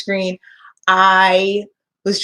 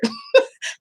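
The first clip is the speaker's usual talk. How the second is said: choked teary sob; 11 semitones higher than usual. Real, not acted. Breaks down mid-story.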